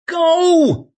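very high, loud screamed command; piercing panicked yell; shriek. A narrator's reading. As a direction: shriek it with all urgency. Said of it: "shrieked Shy".

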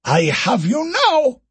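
high, slow triumphant cry. Acted. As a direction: gloat at the breakthrough. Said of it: "cried Okoro".

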